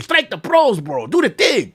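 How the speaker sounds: put-on egging him on in a Latin accent. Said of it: "I'm doing the narration in Spanish".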